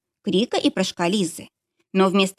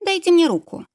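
put-on higher young woman's voice asking; 10 semitones higher than usual. A narrator's reading. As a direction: politely ask for a hand. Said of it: "попросила Лиза".

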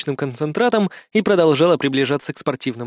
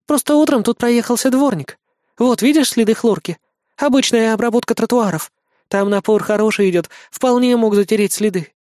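high-pitched, matter-of-fact assistant voice; high voice of a helpful assistant; female assistant; 7 semitones higher than usual. Acted. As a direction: explain the evidence in a higher female voice. Помощница объясняет следы.

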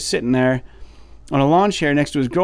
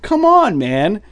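exasperated; 9 semitones higher, much slower than usual. Real, not acted. Exasperated host ranting about the rude heckler. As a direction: throw your hands up in disbelief.

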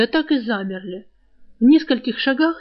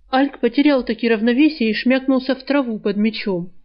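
ordinary delivery, nothing marked

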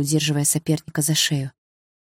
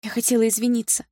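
put-on high, hesitant girl's voice; embarrassed and small; awkward apology; 7 semitones higher than usual. A narrator's reading. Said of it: "сказала она, не зная, куда деть взгляд".

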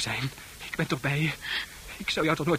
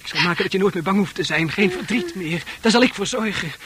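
fast, higher, louder, rising intensity; feverish promise; 7 semitones higher, 7 dB louder than usual. Acted. Arn promising Fran, feverishly rising. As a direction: promise it with growing fervour.

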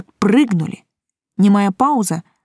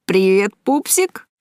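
lightly voiced leering guy; a touch of cocky familiarity in a character voice; cheeky young thug; slower than usual. A narrator's reading. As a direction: toss it out as a teasing come-on. Guy accosting the shopkeeper.

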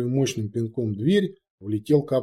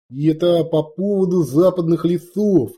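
obsequious; 6 semitones higher, 5 dB louder than usual. A narrator's reading. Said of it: "подобострастно объяснил Копыто".

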